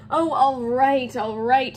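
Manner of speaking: loud, drawn-out giving in; slow, grudging surrender; exasperated agreement. Acted. Harry gives in to Hermione, exasperated.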